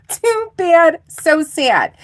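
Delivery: high, loud teasing jeer; mock taunt. Reader mocks Virgo's jealous rival, taunting. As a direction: repeat the playground taunt with fake pity.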